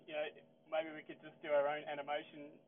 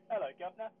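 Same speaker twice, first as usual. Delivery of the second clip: put-on English accent; 5 semitones higher, 7 dB louder, faster than usual. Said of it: "can you do English accent".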